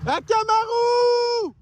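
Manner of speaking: high, drawn-out call across the woods; long high hail to a lost dog. Real, not acted. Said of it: "ça fait une heure que je crie".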